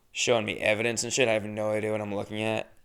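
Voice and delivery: ordinary delivery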